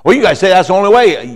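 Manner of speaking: hammed-up annoyed listener; upset objection. A preacher imitating people upset about Jesus, fast and loud. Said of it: "they get upset".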